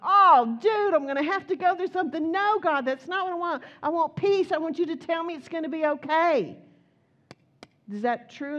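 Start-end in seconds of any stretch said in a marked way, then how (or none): 0.0-6.6 higher and louder mock whining at God; hammed-up loud protest, pitched up; whiny protest. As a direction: complain like a child refusing the hard road. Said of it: "And I'm like".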